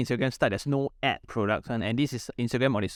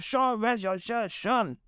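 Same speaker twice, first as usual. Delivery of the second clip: high, slow exasperated call; scolding boss; 12 semitones higher, slower than usual. Retold or imitated. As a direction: call him out like an annoyed boss. Boss scolding about missing shirt.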